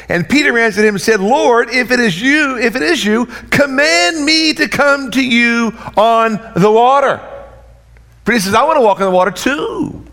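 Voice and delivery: high, loud eager preaching; full-force preaching. Acted. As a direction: preach Peter's plea with fire. Preaching, voicing Peter.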